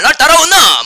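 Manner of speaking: high, loud Gyeongsang shout; Gyeongsang dialect. Shown as a demonstration. Preacher retries the call to disciples, punchy Gyeongsang accent. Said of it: "차라리 경상도 말".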